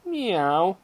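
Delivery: high-pitched kitten meow; pleased kitten meow. A narrator's reading. Kitten snuggling up to the girl, pleased meow. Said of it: "The kitten meowed in pleasure".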